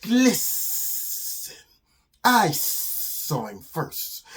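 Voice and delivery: slow, drawn-out sibilant snake voice; hissing snake. Acted. Snake claims its prey from the lizard, hissing. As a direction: stretch every S into a long hiss. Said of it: "And the snake says".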